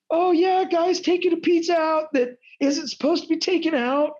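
mock police voice. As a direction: play a silly cop reporting a pizza crime, high.

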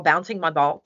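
normal talking voice

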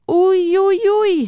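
high, drawn-out oh-dear; playful warning, pitched well up; mock alarm. A narrator's reading. Narrator warns listeners about the hedgehog's mornings, mock alarm.

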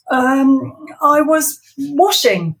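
high, loud stammering excuse; flustered Ivor. Acted. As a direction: scramble for an excuse. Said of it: "Ivor stammers".

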